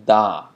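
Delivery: Da with the tongue tip on the teeth; a crisp dental Da. Shown as a demonstration. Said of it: "You're making a d".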